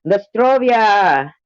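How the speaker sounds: put-on high, drawn-out toast in a Russian accent; mock Russian. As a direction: play a Russian raising a glass, slowly. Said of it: "Russians do kinda roll their R's".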